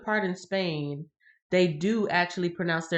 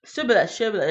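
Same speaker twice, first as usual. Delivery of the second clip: mock challenge; 4 semitones higher, 6 dB louder, faster than usual. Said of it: "sorry, you're from the".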